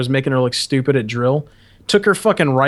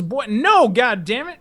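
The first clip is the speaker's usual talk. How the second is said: appalled instructor; 8 semitones higher, slower than usual. Retold or imitated. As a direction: imitate a superior recoiling from the answer. Instructor scolding cadet, retold.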